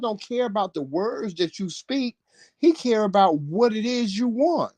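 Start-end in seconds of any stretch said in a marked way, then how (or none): none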